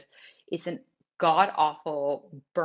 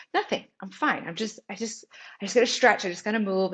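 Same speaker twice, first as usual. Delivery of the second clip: higher-pitched, hurried-sounding reassurance; retold brush-off; 4 semitones higher than usual. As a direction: act out waving away a child's worry. Brushing off her worried daughter.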